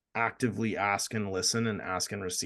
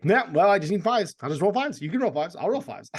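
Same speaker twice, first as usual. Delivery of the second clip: higher, louder, cocky voice chasing the dice; reckless gambler; 8 semitones higher, 5 dB louder than usual. Retold or imitated. Retelling his own tilt at the table, cocky and higher. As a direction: sell it like a player on tilt betting on fives. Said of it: "I've absolutely done that where I'm like".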